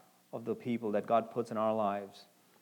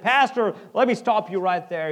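mock interrupting call, a touch higher; retold shout; 7 semitones higher, 8 dB louder than usual. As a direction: call out to stop the preacher. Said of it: "he shouted out aloud".

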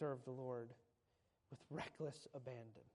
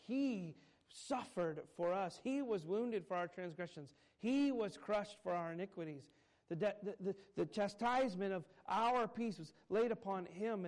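rising, louder preacher's climax; preaching at full force; 4 semitones higher, 7 dB louder than usual. Acted. Preacher driving home the suffering to the congregation, rising and louder. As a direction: build it like a revival sermon.

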